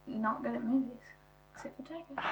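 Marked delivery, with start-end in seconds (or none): 1.5-2.2 very soft whisper; whispered aside. Real, not acted. Whispered exception.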